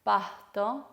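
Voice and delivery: "pasto" with the s as an h before the t; aspirated S. Shown as a demonstration. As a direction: breathe out the s in "pasto". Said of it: "sino pasto".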